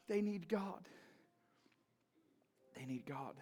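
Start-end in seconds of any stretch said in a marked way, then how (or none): 2.8-3.4 hushed repeat. Real, not acted. Repeated line, quiet echo.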